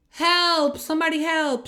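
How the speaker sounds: half-acted yell for help; mock scream for help. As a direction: call out like someone trapped underground. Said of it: "and actually screamed".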